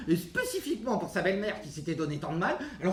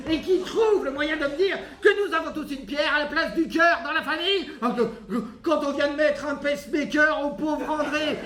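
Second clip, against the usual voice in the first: high, shouted, breathless tirade; furious rant; 6 semitones higher than usual. Acted. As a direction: pile up grievances in a rising shout. Mother ranting at her son.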